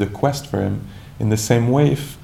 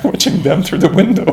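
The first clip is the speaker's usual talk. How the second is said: higher and louder through laughter; amused, laughing; 9 semitones higher, 7 dB louder than usual. Acted. Teacher joking about spying on other meditators, laughing. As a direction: laugh while confessing it.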